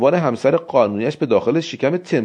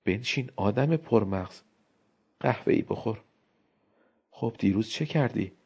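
softer lightly voiced wife; lazy teasing, much softer; wife chatting over coffee, softer; Elena, idle chatter; 3 semitones lower, 10 dB quieter than usual.

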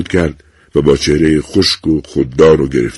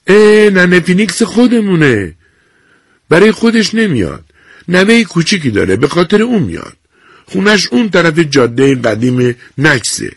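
put-on higher, brisk young nurse recognizing a regular; bright-eyed recognition, chatty to a colleague; 12 semitones higher, 4 dB louder than usual. A narrator's reading. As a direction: nurse telling the clerk about the old woman, higher voice. Nurse voiced in story.

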